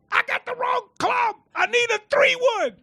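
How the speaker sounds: very high, loud shout; shouting far away. Retold or imitated. Golfer shouting to caddy far down the fairway, loud shout. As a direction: call it to someone far down the fairway.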